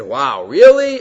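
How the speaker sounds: loud, swooping astonished reaction; mock amazement. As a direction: act out being amazed by the news. Acting out the listeners' astonished reaction.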